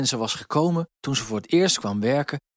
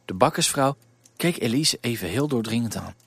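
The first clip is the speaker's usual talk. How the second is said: ordinary delivery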